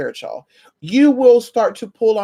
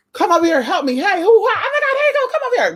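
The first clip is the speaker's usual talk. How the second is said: much higher, eager calling voices with a laugh; partygoers calling out; 14 semitones higher than usual. Retold or imitated. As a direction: call her over excitedly. Everyone calling her over at party.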